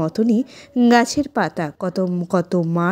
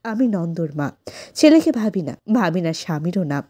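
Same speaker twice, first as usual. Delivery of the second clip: normal talking voice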